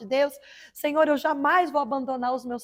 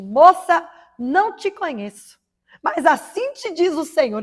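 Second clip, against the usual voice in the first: much higher, louder prophetic call; a preacher announcing God's word to a stranger; prophet's declaration; 6 semitones higher, 7 dB louder, slower than usual. Retold or imitated. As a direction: proclaim it like a prophet seizing the room.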